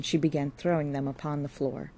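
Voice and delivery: usual speaking voice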